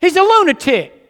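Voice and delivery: a bystander scoffing, rising loud on lunatic; mock bystander. Passer-by on the street dismissing a preaching man, scoffing. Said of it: "we'd say".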